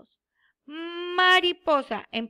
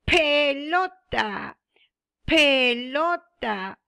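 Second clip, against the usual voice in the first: pelota said slowly, each syllable spaced; teacher slowly modelling a picture word for pupils; slow model word; 4 dB louder, much slower than usual. Shown as a demonstration. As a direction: stretch it out for the class.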